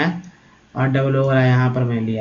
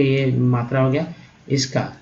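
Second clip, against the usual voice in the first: ordinary delivery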